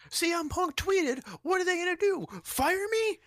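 very high, stretched parody commentator; hammed-up squealed commentator call; mock announcer shout. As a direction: yell the tweet out like breaking news. Replays the commentator's tweet report.

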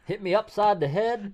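put-on high, loud hillbilly accent. Riffing on a dog flung back out of the hole, hillbilly accent. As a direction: play a rowdy backwoods hillbilly. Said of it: "talk like that".

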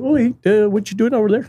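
hammy Canadian guy; Canadian accent. Retold or imitated. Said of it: "the Canadian accent's fun".

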